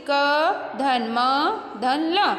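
each letter drawn out slowly; teacher spelling letters slowly. Shown as a demonstration. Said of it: "अलग-अलग वर्ण दिया गया है".

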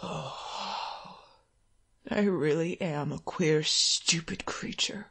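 low, soft mutter; a weary father muttering. Acted. Ill father muttering to himself.

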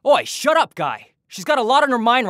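indignant outburst, pitch jumps up; angry snap. Acted. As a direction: bite back at the rude guy. Snapping back at rude clerk.

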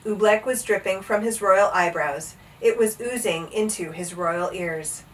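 ordinary delivery

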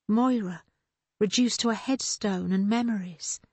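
usual speaking voice

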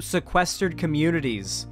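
ordinary talk, nothing marked

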